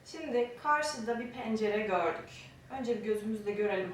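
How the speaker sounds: ordinary delivery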